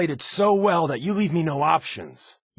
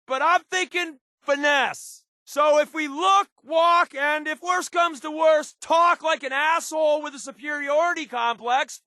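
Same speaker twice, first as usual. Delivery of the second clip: high, raised voice carrying over engine noise; shouting over rotors. Acted. Companion briefing the boss mid-flight, shouting over the rotors. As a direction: call out the plan above the roar of the rotors.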